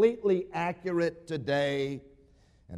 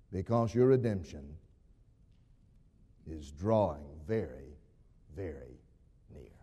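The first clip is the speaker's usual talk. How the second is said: hushed promise; 12 semitones lower, 6 dB quieter than usual. Acted. Preacher promising redemption to the congregation, hushed and fading. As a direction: drop low and soft, fading away.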